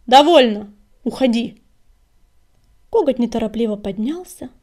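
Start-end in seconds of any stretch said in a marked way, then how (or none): 0.1-1.6 loud, firm dismissal; commanding, curt exclamations; loud order. A narrator's reading. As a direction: order them out in a raised voice. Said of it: "громко сказала синяя звезда".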